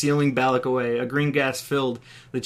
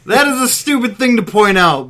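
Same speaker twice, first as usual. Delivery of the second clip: loud, higher shouted retort; angry shout; 8 semitones higher, 7 dB louder than usual. Acted. Balak shouting back at the taunting captor, angry. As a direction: yell it back in disgust. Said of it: "Balak shouted".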